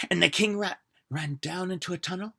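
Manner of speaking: ordinary delivery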